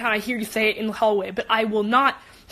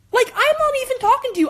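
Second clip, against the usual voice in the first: much higher, a touch louder; exasperated; 11 semitones higher, 4 dB louder, faster than usual. Retold or imitated. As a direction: fling it at him, fed up. Retold snapping at classmate.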